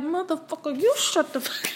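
a mock clapback pitched well above her own voice; mock angry retort. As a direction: snap back at the parent in mock fury. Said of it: "and I'm over here like".

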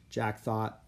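usual speaking voice

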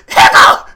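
sudden high, loud imitated outburst; guardsman's yelp. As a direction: blurt out a piercing comic yelp. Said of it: "the guardsman go".

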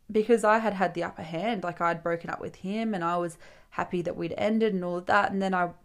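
ordinary talk

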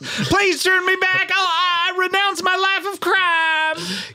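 high pleading voice. Acted. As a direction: beg to be turned back. Transformed thief begs.

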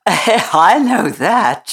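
slow, easy country maid voice; cheerful Yorkshire housemaid, slow; good-natured Yorkshire maid. Acted. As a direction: shrug it off, unbothered. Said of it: "laughed without seeming the least out of temper".

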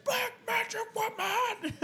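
mock scream. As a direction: screech it like a wild rock singer. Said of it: "you would fucking scream".

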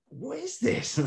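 very high, squeaky mock-baffled voice; mock bewilderment. American kids hearing Geordie for the first time, high mock bewilderment. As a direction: leap right up high in comic disbelief.

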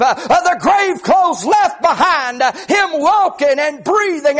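high, driving Southern-revival preacher cadence; full-force preaching. Acted. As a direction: preach it hard in rising, rhythmic phrases.

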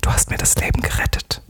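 breathy hushed whisper, barely voiced; soft grateful whisper. A narrator's reading. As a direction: whisper it close, almost no voice. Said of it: "und flüsterte".

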